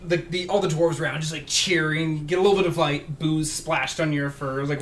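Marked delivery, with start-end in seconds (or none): none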